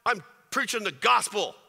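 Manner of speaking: imitating angry Paul. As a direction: play Paul fed up and shouting at God. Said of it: "Paul could have been angry".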